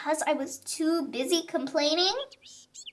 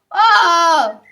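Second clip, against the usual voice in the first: loud frustrated oh; loud dismayed oh; loud exasperated oh; 4 semitones higher, 13 dB louder than usual. A narrator's reading. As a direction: cry out in dismay. Exasperated exclamation in story.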